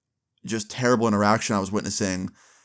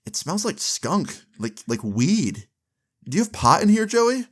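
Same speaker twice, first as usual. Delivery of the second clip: accusing; 9 semitones higher than usual. Retold or imitated. Dad finds weed, confronts son.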